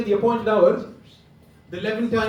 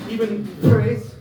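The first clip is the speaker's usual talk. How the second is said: mock-grand slow stress on "even Thrace"; slow emphatic "even Thrace"; 4 dB louder, much slower than usual. Shown as a demonstration. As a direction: draw out "even Thrace" slowly, every word weighted. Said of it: "We have to say it with an emphasis".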